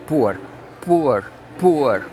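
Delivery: poor said with a u sound, like pur; poor pronounced pur. Shown as a demonstration. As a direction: say poor with a u vowel. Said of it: "como se fosse P U R".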